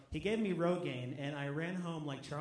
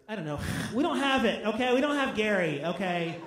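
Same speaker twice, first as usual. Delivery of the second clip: loud mock-exasperated "we don't have it"; exasperated refusal; 3 semitones higher, 9 dB louder than usual. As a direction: bark it out like a fed-up translator. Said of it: "And I was like".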